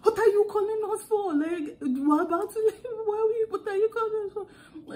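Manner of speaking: put-on high, indignant African-accented scolding. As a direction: demand it loudly in African English. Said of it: "I started speaking African".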